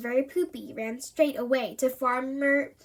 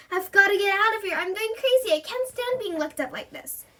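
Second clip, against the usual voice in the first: panicked; 7 semitones higher than usual. A narrator's reading. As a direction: plead desperately to be let out. Jill panics about being locked up.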